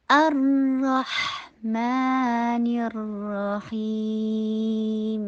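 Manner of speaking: sustained syllable-by-syllable Quran recitation; slow recitation. Shown as a demonstration. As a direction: recite slowly, holding each long vowel. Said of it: "اتنا ہی آہستہ پڑھنا ہے".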